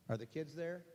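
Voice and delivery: very soft, small questioning voice; quiet, rising question; hushed repeat. Real, not acted. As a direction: repeat the question under the breath. Repeats the question far more softly.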